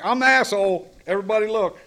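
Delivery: loud, high self-mocking proclamation; mock loud announcement. At a meeting, calling himself the asshole, mock loud proclamation. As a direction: announce yourself as the fool to the room.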